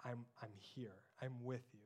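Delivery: tender father to frightened daughter; soft reassuring father. Retold or imitated. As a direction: soothe a scared child back to sleep. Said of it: "I get to say back to her".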